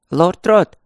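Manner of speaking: calling from down the corridor; loud call from afar; from another room. A narrator's reading. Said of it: "басовито крикнул из коридора".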